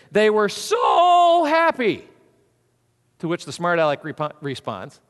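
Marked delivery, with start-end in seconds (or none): 0.1-2.1 slow, high and loud "so happy"; "so" dragged out and called high; drawn-out exclaiming "so"; stretched so. Shown as a demonstration. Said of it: "example in the dictionary".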